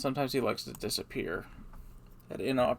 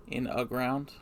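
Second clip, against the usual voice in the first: ordinary talk, nothing marked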